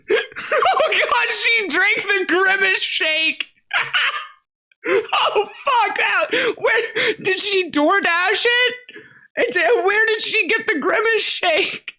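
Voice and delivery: laughing. Real, not acted. Streamer losing it laughing.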